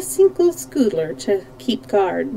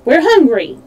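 high, loud hungry shout; 6 semitones higher, 6 dB louder than usual. A narrator's reading. Scoodlers shouting their hunger at the prisoners, high and loud. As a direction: shout it out greedily. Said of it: "they shouted in the next breath".

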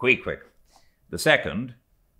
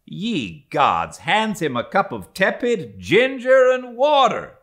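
ye gods and hands him flung out much higher and louder, indignant; mock outrage; 14 semitones higher, 8 dB louder than usual. A narrator's reading. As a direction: throw up your hands at the absurd cup. Narrator's mock-outraged exclamation.

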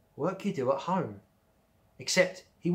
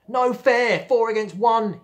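much higher and louder, near tears; shout; 10 semitones higher, 11 dB louder, slower than usual. Acted. Bradley beaten, shouting at the girls. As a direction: howl it out, close to crying. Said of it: "he shouted".

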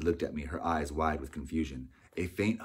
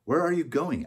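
called out high and loud; calling out; 10 semitones higher, 7 dB louder than usual. Acted. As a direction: shout after someone leaving. Frederick calls after narrator.

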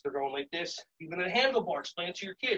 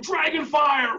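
high, slow, loud dragon cry; dragon fire shout; 7 semitones higher, 5 dB louder, slower than usual. Shown as a demonstration. Teacher cueing dragon fire breath, loud cry. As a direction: let the fire roar out slowly. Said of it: "Big belly breath in".

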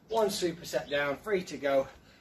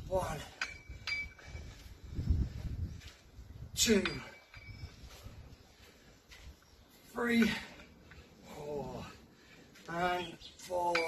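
counting workout reps; 3 semitones higher, 3 dB quieter, much slower than usual. Real, not acted. Coach counting final reps, building.

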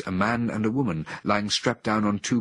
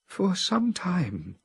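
much higher, very slow and hesitant; a woman speaking in a trance, anxious; 12 semitones higher, much slower than usual. A narrator's reading. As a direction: drag the words out, worried. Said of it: "said the anxious voice".

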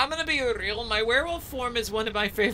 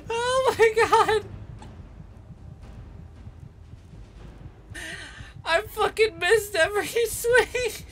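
laughing in disbelief; 8 semitones higher than usual. Real, not acted. Player who missed every swing, laughing in high disbelief. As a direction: squeal it through helpless laughter.

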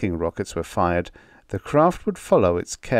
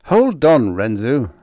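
loud, higher sharp order; commanding; 5 semitones higher, 7 dB louder than usual. A narrator's reading. Captain grabbing the scientist's arm, loud command. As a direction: stop him mid-swing. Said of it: "Arlen commanded".

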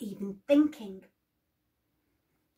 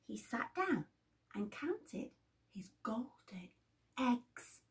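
hushed telling; 11 dB quieter than usual. A narrator's reading. Hushed as giant counts.

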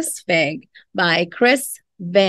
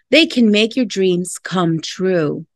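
usual speaking voice

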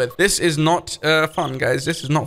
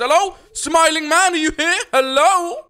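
calling out; 8 semitones higher than usual. Real, not acted. Gamer calling for the Smiling Man, calling out. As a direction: sing out a searching hello.

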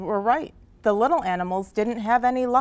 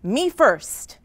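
higher, eager call; eager shout; 5 semitones higher, 4 dB louder than usual. Acted. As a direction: jump in to claim the turn. Elephant claims the first turn.

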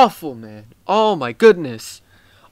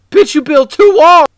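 much higher and louder, teasing outburst; mocking yell; 11 semitones higher, 9 dB louder than usual. Real, not acted. Gamer ribbing another player's tiny build, high mocking yell. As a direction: roast them with a shout.

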